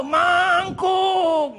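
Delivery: high, long-held yell; shouted call. Retold or imitated. Passing lady shouts at the man who blocked the road, high yell. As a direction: holler it long, as if calling down the street. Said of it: "But she shouted".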